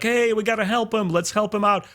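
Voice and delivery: high, keen call to help out; eager rallying call. Retold or imitated. Family members urging each other to rescue him, eager and high.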